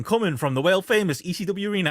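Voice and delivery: Shawn Michaels voiceover. Retold or imitated. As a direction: raise the pitch a little, like an imitated advert announcer. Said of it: "it's got Shawn Michaels doing the voiceover".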